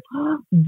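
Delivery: mock gasp of horror; sharp theatrical intake of breath; shocked gasp. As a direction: gasp as the scandalised hairdresser. Hairdresser's shock, acted.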